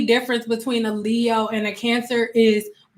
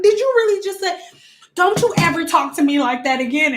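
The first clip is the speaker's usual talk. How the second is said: mock telling-off; 6 semitones higher than usual. Acted telling-off on the phone.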